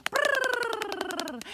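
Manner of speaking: loud, high trilled brrr; long rolled drumroll of running feet; rolled running noise. Pretend. Running sounds with the children.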